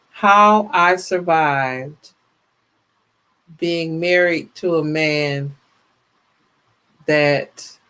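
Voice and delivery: slow, drawn-out title announcement; slow title read. Shown as a demonstration. Host testing a story title aloud, slow and deliberate. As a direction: spell out the title slowly, testing it. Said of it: "trying out a story title".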